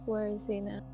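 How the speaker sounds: usual speaking voice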